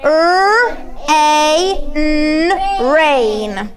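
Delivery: R, A, N each sound said slowly and apart, then rain blended. Shown as a demonstration. Teacher showing the class how to sound out rain. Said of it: "So it will be like this".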